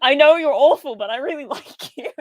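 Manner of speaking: high and loud, then fading into a laugh; giggly mock reproach; laughing fond scolding. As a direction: scold a crush while laughing.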